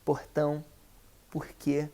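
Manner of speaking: regional Brazilian accent, aspirated H-like R in porta, portão, porquê; regional Portuguese accent. Shown as a demonstration. Teacher shows another listener's accent, H-sounding Brazilian R. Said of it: "you speak".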